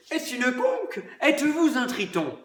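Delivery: high, dreamy poetic wonder; lyrical. Acted. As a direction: rhapsodize with a laugh. (lyrique et rêveur)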